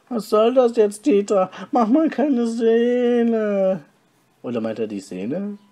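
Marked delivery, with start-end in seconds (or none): 0.1-3.8 high, loud, slowed protest; raised voice. Acted. Detlef pleading with furious Dieter, high and loud. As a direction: raise your voice to calm him down. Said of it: "Sagte Detlef mit gehobener Stimme".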